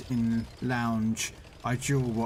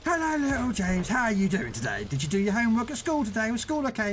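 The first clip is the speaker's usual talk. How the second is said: put-on higher, sing-song voice for a little kid; talking to a child; 10 semitones higher than usual. Streamer greeting his mate's young son, sing-song and high. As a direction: chat sweetly to little James through the screen. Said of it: "Say hello to him".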